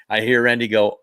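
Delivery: normal talking voice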